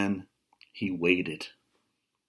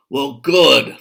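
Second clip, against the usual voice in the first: very loud booming troll; thundering troll; 4 semitones higher, 12 dB louder than usual. Acted. Troll thunders at the biggest goat. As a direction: thunder it out gleefully. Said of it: "thundered the troll".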